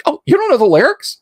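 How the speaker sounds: high, incredulous mock question; perky coworker. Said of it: "in this manic Midwestern accent".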